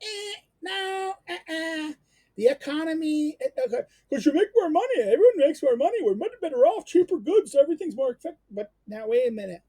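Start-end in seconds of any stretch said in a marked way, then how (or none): 0.0-8.4 high, put-on voice as Aristotle dismissing the free market; mock Aristotle. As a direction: play the philosopher waving it off, higher. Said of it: "Aristotle would say".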